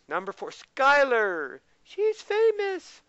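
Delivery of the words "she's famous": high, slow, breathy-free gush; awed exclamation. Acted. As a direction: say it with big mock awe. Mock-awed comment on Skylar.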